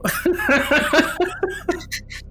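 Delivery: high burst of laughter. Real, not acted. Player cracks up at fumble.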